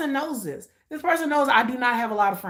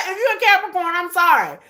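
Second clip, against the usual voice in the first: higher, louder, through a real laugh; amused, raised-pitch apology; 6 semitones higher, 6 dB louder than usual. Real, not acted. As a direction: laugh as you apologize to the listeners. Laughing apology after the curse.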